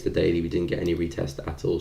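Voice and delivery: ordinary talk, nothing marked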